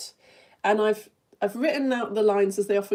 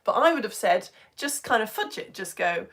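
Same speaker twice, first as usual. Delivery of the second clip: ordinary talk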